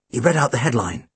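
ordinary delivery

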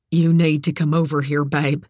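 usual speaking voice

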